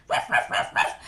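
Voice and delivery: breathy puppy ruffs; dog bark. Shown as a demonstration. Showing the children how the dog barks. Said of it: "We bark".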